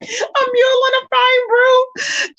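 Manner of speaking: high, slow and cracking with laughter; laughing through it. Real, not acted. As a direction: squeeze the words out between laughs. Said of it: "Child, I am dying".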